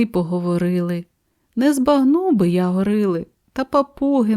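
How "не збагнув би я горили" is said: usual speaking voice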